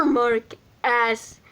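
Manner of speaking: plain reading voice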